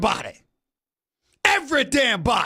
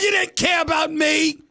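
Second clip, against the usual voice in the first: normal talking voice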